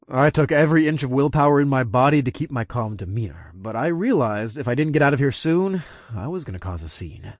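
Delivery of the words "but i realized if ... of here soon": ordinary delivery, nothing marked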